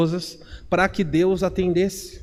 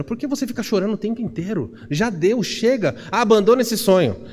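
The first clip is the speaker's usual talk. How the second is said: higher-pitched, impatient scolding, imitated; exasperated reproach, pitched up; mock condemnation; 5 semitones higher than usual. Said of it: "você vê a condenação dizendo".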